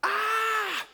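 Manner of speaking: high, loud hammed-up yell of panic; imitating the Gibeonites yelling for help; mock yell. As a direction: let out a panicked shout. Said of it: "It's like they're yelling".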